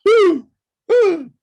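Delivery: high, loud cheering whoop; gleeful cheer at the movie scene; excited whoop. Real, not acted. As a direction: whoop it up with delight.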